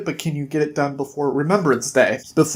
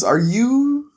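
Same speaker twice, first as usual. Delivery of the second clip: high-pitched, halting then rushed disbelief; retold surprised question; 7 semitones higher than usual. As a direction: imitate someone taken aback by an invitation. Snow surprised by invitation to ball.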